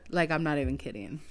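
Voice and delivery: ordinary delivery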